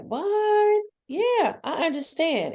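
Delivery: high-pitched astonished reaction; acted shock. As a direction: squeak in disbelief, then settle. Acting child's shock, parent's answer.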